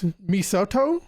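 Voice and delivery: Misoto said mee-SO-toh, rising like a question; unsure pronunciation. Shown as a demonstration. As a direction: try Misoto as mee-so-toh, long O in the middle. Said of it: "I don't know. I'm gonna say".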